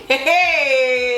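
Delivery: high, drawn-out mock cry; mom screaming. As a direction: imitate mom crying out in alarm. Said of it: "My mom started screaming".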